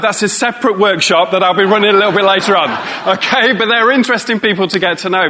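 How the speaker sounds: ordinary delivery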